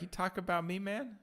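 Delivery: light playful voice for the sleepy pug; voicing the dog. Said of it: "He's like".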